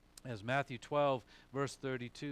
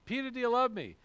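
questioning Jesus to Peter; 9 semitones higher, 7 dB louder, faster than usual. Acted. Acting Jesus repeating the question.

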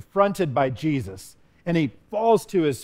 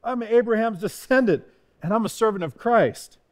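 higher-pitched retold boast; 6 semitones higher than usual. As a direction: lay out credentials with mild pride. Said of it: "he's boasting, but he's also telling him".

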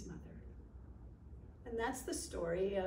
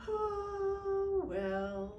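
disappointed; 9 semitones higher than usual. Acted. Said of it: "cried Michael to himself".

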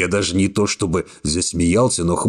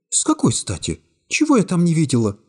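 put-on lightly raised young woman voice, cool and calm; young woman's voice; 11 semitones higher than usual. A narrator's reading. Secretary coolly refusing the hero's embrace, high young woman voice. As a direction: voice her higher, calmly distant.

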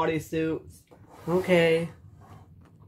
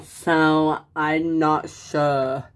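ordinary talk, nothing marked

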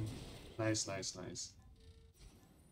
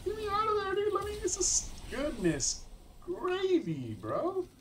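very high, loud panicked squeal; frantic squeal; 6 dB louder than usual. Real, not acted. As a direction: squeal your way out of danger, stretching the last words. Frantic escape during live game.

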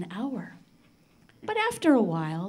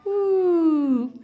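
high, loud ooh of surprise; surprised ooh; 7 semitones higher, 6 dB louder than usual. Acted. Storyteller reacting to the candles burning out, surprised ooh. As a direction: let the ooh pop out in wonder.

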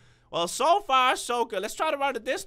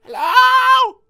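long, high, very loud holler; scared shout; 11 semitones higher, 14 dB louder than usual. Real, not acted. Scared player calls into the hallway, hollering. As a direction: holler it out from nerves. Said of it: "what happens when I get scared".

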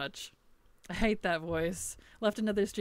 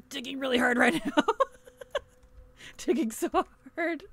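laughing; 6 semitones higher, 3 dB louder than usual. Real, not acted. Laughing mid-game.

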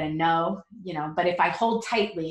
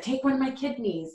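begging; 6 semitones higher than usual. Retold or imitated. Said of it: "you're begging".

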